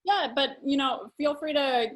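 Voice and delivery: ordinary talk, nothing marked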